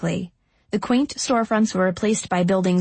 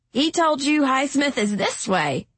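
irritated; 6 semitones higher than usual. A narrator's reading. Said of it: "sounding irritated".

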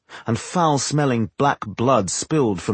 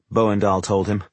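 ordinary delivery, nothing marked

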